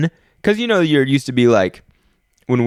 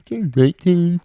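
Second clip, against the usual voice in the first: imitating the old Siri, pitched higher; mock early-phone assistant giving directions; 8 semitones higher, slower than usual. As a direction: put on a stilted robotic navigation voice. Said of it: "It's like".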